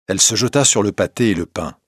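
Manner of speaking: ordinary delivery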